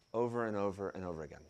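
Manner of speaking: over and over said soft and fast; hushed repeat. Real, not acted. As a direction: let the repeat fall away. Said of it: "repeated quieter".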